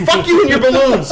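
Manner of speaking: high, loud exasperated yell through a laugh; mock angry yell. Telling off his friend over the CB, laughing yell. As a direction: blow up at the prank, laughing. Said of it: "And I'm like".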